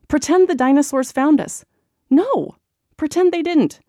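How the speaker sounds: put-on high, loud squabbling kid voices; arguing kids. A narrator's reading. Kids in the blanket fort argue over the game, high and loud. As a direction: bicker like little siblings, pitched high. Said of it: "arguing voices".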